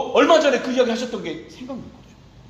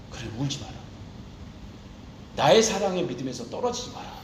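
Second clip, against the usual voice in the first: tender consoling voice; 7 semitones lower than usual. Acted. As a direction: hush it down, like a father soothing a crying child. Jesus voiced consoling Peter.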